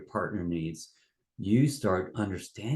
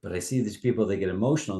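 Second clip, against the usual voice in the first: ordinary talk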